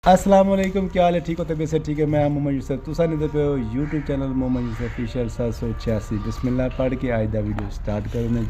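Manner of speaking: ordinary delivery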